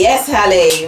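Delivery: cheering loudly across the kitchen; calling out. Real, not acted. Mum cheering Hallie's muscles, loud call. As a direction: shout her name in praise.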